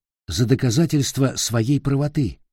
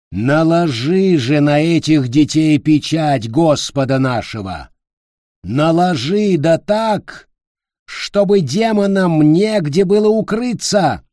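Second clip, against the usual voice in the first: fiery preacher; 5 semitones higher, 4 dB louder than usual. A narrator's reading. Preacher commanding the brand.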